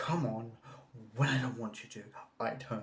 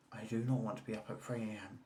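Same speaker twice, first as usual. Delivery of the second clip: normal talking voice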